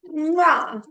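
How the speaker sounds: blown air kiss; kiss sound. Acted. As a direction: smack a big air kiss.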